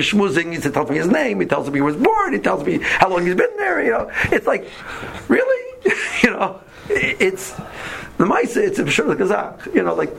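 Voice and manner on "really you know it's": laughing. Real, not acted. Delighted by taxi chat.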